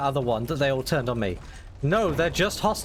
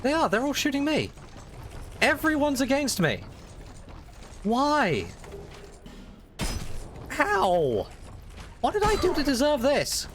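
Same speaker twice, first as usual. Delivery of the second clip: high, whiny woe-is-me; long-suffering; 9 semitones higher than usual. Real, not acted. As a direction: complain like a martyr. Whining about everyone attacking.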